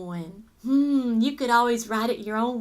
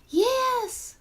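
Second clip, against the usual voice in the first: high, drawn-out cheer; excited yes; 12 semitones higher than usual. Acted. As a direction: answer like an eager audience, pitched way up. Answering for the excited audience.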